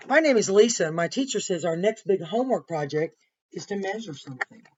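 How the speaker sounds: lively picture-book narration; reading to children. A narrator's reading. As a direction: read it to the class with energy. Reads story aloud to class.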